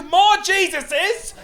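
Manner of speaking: very high, loud, drawn-out cry; exasperated shout. Acted. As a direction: shout it in hammed-up disbelief. Mock exasperation at Jesus pointing.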